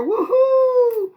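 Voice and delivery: high, loud whoop of joy; cheer. Real, not acted. Cheering about skipping chapters.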